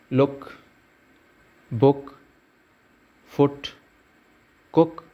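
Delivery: short "oo" in लुक, बुक, फुट, कुक; short vowel. Shown as a demonstration. Teacher showing double-o words to learners, short vowel in लुक, बुक, फुट, कुक. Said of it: "short sound देगा".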